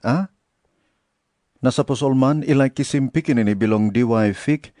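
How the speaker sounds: plain reading voice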